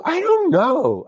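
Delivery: very high, drawn-out wail; retold helpless panic. Actor asked what he will do, desperate wail. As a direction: stretch it out in mock desperation.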